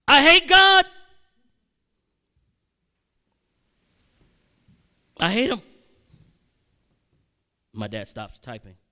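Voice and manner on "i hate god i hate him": explosive high-pitched shout; yelling in an uncontrolled outburst; sudden yell. Retold or imitated. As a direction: blurt it out at full volume. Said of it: "I didn't expect to yell that out".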